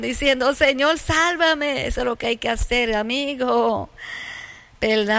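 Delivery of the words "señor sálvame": a mock desperate plea, pitched higher and drawn out slowly; desperate cry for help. A narrator's reading. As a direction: cry out like a sinking man begging to be saved, slowly. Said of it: "gritó con desesperación".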